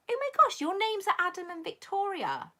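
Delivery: high-pitched amazed exclamation; astonished. Real, not acted. Streamer discovers a viewer couple share names, astonished. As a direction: let the surprise send your voice way up.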